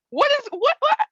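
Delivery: voice going up. Acted. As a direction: squeak it out in a frenzy. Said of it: "I can imagine her voice going up".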